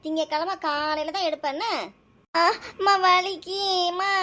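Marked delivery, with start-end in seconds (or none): 2.3-4.1 high, drawn-out whine of pain; pained wail. Acted. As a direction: wail slowly to mum as the ear hurts. Child's ear pulled by scolding mother. (வலியில் இழுத்துச் சிணுங்கும் குரல்)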